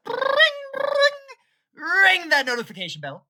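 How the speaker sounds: high, loud, fast hype-man push; hyped outro. Acted. Youtuber urging viewers to ring bell, loud and hyped. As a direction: sell the bell like an announcer.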